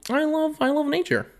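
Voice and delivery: very high airy mock gush; hammed-up high mockery; mock dreamy voice. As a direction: swoon over nature in a falsetto. Mocking the bio writer.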